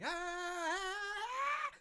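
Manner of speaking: a very high sung wail; sung scream. Retold or imitated. Vlogger imitates Martin's background scream, very high wail. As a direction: hit a very high falsetto cry. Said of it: "But he's, like, proper screaming".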